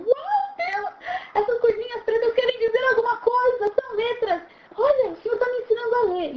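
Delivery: overwhelmed high delight; astonished joy. Acted. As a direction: cry out in amazed discovery. Acts amazement at learning to read.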